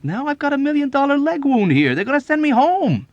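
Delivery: very high, loud insistence; heated. A narrator's reading. Wounded airman refusing the murder plot, heated insistence. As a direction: insist at the top of your voice.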